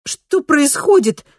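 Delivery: high, loud, frightened outburst; nervous cry. A narrator's reading. Kristina locked out of her home, nervous cry. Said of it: "нервно выкрикнула Кристина, испуганная тем".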